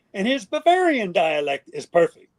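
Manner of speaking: ordinary talk, nothing marked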